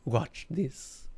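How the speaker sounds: slow, tired alien drawl; the alien, sick of it, speaks slowly; weary alien voice. Acted. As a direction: sound worn out by explaining it all.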